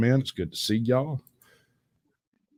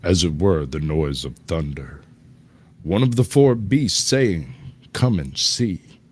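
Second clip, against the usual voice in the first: scripture reading; 4 semitones lower, 4 dB louder than usual. Acted. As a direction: read it like doom is coming.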